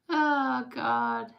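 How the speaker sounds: usual speaking voice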